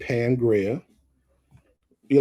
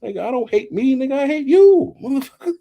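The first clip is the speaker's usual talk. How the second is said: loud, high outburst building on the last clause; heated comeback; 14 semitones higher, 7 dB louder than usual. Acted. Vlogger mocking the self-hate excuse, indignant and loud. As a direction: throw the accusation back, pitch soaring.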